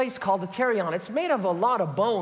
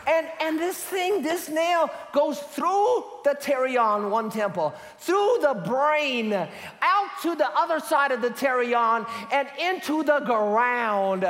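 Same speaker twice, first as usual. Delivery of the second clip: higher, slower, half-shouted gore; excited building; 6 semitones higher than usual. Retold or imitated. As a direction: drag out the grisly details. Gory nail story, vivid retelling.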